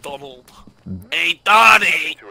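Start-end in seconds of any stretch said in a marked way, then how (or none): 1.1-2.2 slow loud call. Acted. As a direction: call out slowly and loudly. Calling the ghost.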